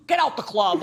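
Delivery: laughing quote of the bouncer line. Retold or imitated. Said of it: "the bouncer voice".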